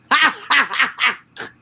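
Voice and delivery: loud, high-pitched laughing fit; bursting out laughing. Real, not acted. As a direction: crack up helplessly mid-reading. Breaks up while reading the report.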